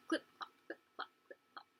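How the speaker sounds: soft, unvoiced clip-clop; imitated hoofbeats. Child on a carriage ride imitating the horse, imitated hoofbeats. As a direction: click out hooves fading away. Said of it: "the clip-clop of the horse's hooves".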